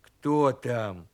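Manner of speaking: low-voiced question; bear cub asking. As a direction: ask it low, with a falling end. Bear cub answers a knock.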